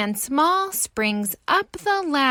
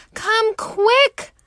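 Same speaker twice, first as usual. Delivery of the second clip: high, loud urgent plea; urgent cry; 10 semitones higher, 6 dB louder than usual. Acted. Mother Rabbit crying out to the fireman. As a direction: cry out for help. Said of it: "Mother Rabbit cries".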